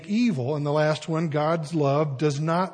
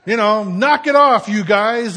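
loud, high yell to break up a fight; big sudden call, easing slightly after the first word; acted shout; 6 semitones higher, 13 dB louder than usual. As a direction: holler at scrapping kids to stop.

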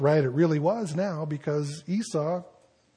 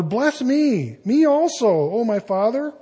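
higher, louder, begging; bitter pleading cry; 6 semitones higher, 5 dB louder than usual. Acted. Esau begging his father for a blessing, higher pleading cry. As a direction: plead with your father, voice lifted high. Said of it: "he cried with an exceedingly great and bitter cry".

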